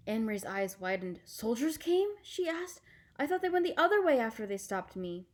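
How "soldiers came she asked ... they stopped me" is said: put-on high girlish voice, worried; a child hearing danger was near; alarmed girl. Anne-Marie asking her uncle, high and worried.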